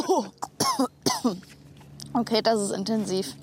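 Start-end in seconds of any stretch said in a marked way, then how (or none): 0.3-1.4 sudden real cough from the stink; coughing at the stench. Real, not acted. Presenter smelling skunk oil, coughing at the stench. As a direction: choke on the smell, cough hard.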